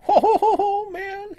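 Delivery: high, loud delighted laughing whoops; overjoyed, laughing it out; excited. Real, not acted. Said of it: "I got a little excited there".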